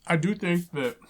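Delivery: talking with a mouthful; words round a bite of food; mouth full. Real, not acted. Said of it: "sorry, I'm talking with my mouth full".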